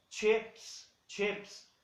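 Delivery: chips with a short vowel close to e, "chéps"; chips demo. Shown as a demonstration. Teacher models chips for students, vowel near e. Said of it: "E o I soa como E".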